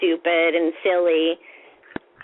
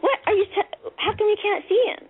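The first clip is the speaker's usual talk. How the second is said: high, incredulous questioning; retold exasperated puzzlement, pitched up; baffled disbelief; 12 semitones higher than usual. Baffled at sister not seeing.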